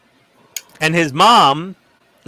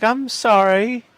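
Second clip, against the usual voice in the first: higher, very slow meek apology; sheepish apology; 9 semitones higher, much slower than usual. Retold or imitated. Host apologizing to the grandma, mock meek. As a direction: shrink back like a scolded kid.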